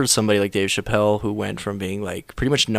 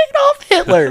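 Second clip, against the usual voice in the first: put-on very high, louder child voice; little Hitler; 6 dB louder than usual. Sketch kid telling a stranger his name, squeaky child voice. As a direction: chirp the name like a little boy. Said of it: "little kid with a Hitler mustache".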